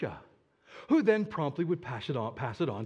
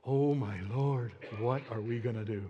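panicked; 6 semitones lower, 4 dB quieter than usual. Acted. Servant seeing the army at dawn, softly panicking. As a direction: gasp it out as the army closes in. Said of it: "He panics".

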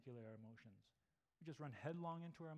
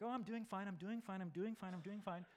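mock denial; 5 semitones higher, 4 dB louder than usual. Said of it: "It's like".

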